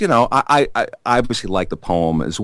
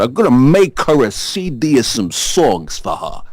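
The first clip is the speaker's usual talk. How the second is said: put-on heavy Brooklyn accent, New York streets; thick Brooklyn accent; 3 semitones higher than usual. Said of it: "I said in my thick Brooklyn accent".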